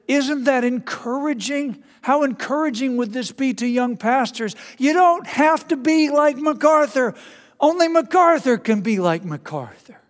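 high, eager encouragement building; John Piper voice. Retold or imitated. Piper cheering on young pastors, high and excited. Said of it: "he's got a high-pitched voice".

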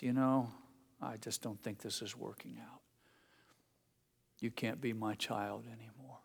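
sinking to a near hush; quiet, letting the child go; hushed rejection. Retold or imitated. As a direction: let it fade to almost nothing. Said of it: "God is on the verge of saying".

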